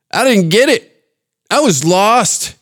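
high-pitched, baffled protest; exasperated bafflement. Retold or imitated. Comic lost at the lady's accent, baffled.

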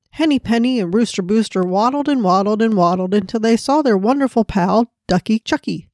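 usual speaking voice

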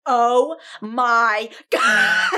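slow, drawn-out horrified wail. Retold or imitated. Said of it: "was horrified".

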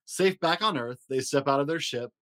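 usual speaking voice

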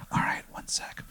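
very soft, voiceless whisper; hushed, furtive whisper; secretive whisper. Acted. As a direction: whisper it so nobody else hears. Character sneaks in at midnight.

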